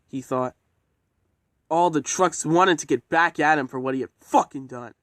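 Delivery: ordinary delivery, nothing marked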